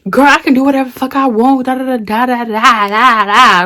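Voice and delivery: fast, running into mock babble; mocking Miss Raggedy. Teller mocks the girl talking back, mocking. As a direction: rattle off a dismissive imitation of a mouthy rival. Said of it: "Miss Raggedy is like".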